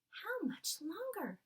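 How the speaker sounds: put-on a little orphan girl waiting impatiently, high and slow; Sophie wondering. Sophie waits on the windowsill, high wondering girl. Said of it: "she wondered".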